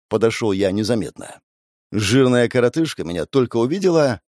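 plain reading voice

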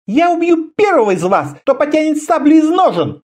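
high, forceful female voice; commanding woman leader. A narrator's reading. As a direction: threaten them with absolute authority, high. Woman leader breaks up a fight. (властный высокий женский голос)

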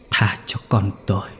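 breathless whispered begging, barely voiced; dying man's last whisper; whispered plea. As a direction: rasp it out like a dying man. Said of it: "thều thào".

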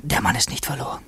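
soft unvoiced whisper, thinking aloud unheard; a secret aside to himself while the governor waits, whispered; whispered inner voice. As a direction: breathe the thought so the governor cannot hear. Inner voice during the conversation.

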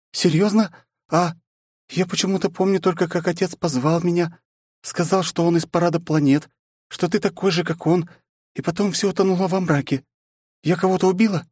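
put-on high, dazed young woman; princess voice. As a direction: wonder aloud, shaken. Princess remembering, confused.

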